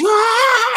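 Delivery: high yelp of pain; mock scream of pain. Darcy cauterizing the wound, a sharp mock scream. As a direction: cry out as the wound burns. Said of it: "she does it and goes".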